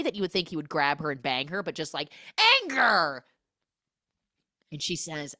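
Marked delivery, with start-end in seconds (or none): 2.6-3.2 loud, higher growl of rage; mock anger. Recapper showing him trashing the desk, mock angry outburst. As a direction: blurt the word as a burst of fury. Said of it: "he throws everything off".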